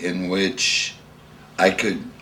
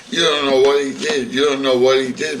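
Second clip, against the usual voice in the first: indignant outcry; 7 semitones higher, 6 dB louder than usual. Retold or imitated. Secretary's upset outcry.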